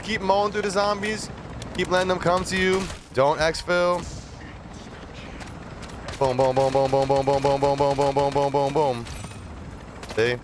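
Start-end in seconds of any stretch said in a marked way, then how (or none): 6.2-9.1 rapid monotone string of booms; imitated gunfire booms. Gamer mowing down zombies, rapid-fire boom noises. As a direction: chant boom on one held note, evenly and quickly.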